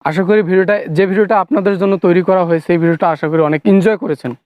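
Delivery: ordinary talk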